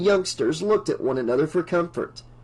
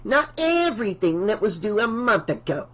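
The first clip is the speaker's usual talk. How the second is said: put-on grouchy old woman voice; 4 semitones higher than usual. Voices old Mrs Deagle.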